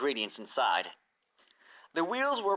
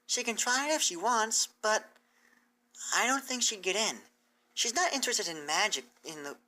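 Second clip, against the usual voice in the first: raised, earnest teacher pony explaining; bright scholarly mare, raised pitch; Twilight's voice; 8 semitones higher than usual. Acted. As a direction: lay out the plan to her hopeful and clear. Twilight gently says no.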